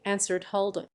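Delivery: usual speaking voice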